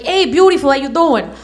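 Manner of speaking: a light Brooklyn guy pickup voice; smooth-talking Brooklyn come-on, lightly done; Brooklyn accent. Pretend. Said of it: "Joey from Brooklyn".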